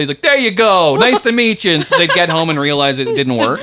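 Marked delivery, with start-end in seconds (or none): 0.2-1.7 loud, high send-off; imitated big, breezy goodbye; Andrew Dice Clay. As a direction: hand it back with fake cheer. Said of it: "take pictures with Andrew Dice Clay".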